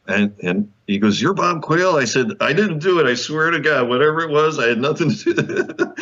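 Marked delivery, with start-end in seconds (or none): 1.2-2.0 high rising surprised question; retold astonishment. Show boss meeting the guest, astonished. 2.4-5.9 high, hurried-sounding comic denial breaking into a laugh; mock protest of innocence. Guest joking to the show boss, mock-defensive.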